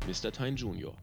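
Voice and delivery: plain reading voice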